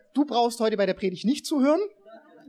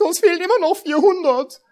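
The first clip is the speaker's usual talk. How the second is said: mock surprise; 11 semitones higher, 7 dB louder than usual. Imitating disappointed earner's thought.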